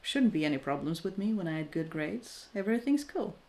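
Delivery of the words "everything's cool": ordinary talk, nothing marked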